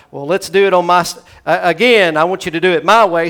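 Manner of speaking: loud, fast, bossy eagerness; eager Peter. Retold or imitated. Peter to Jesus, loud eager insistence. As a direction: push your own plan. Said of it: "Simon Peter replied".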